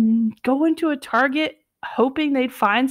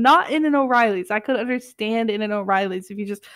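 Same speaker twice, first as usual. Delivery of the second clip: usual speaking voice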